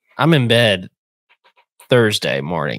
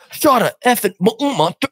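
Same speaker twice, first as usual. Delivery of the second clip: higher, choppy imitation of a call cutting out; garbled phone voice; 13 semitones higher than usual. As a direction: say it like a bad mountain signal is chopping the words. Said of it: "the mountain signal".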